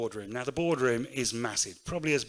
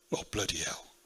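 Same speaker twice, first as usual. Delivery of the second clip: muttered dismay; 6 semitones lower, 4 dB quieter than usual. Retold or imitated. Dad reacts to dying on page five.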